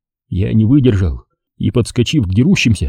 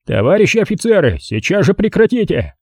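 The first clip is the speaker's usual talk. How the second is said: high-pitched official appeal; summoning call; 8 semitones higher than usual. A narrator's reading. Patrol major behind the brawling officers, calling out. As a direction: call them to order, voice raised in pitch. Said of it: "раздался чей-то призывный голос".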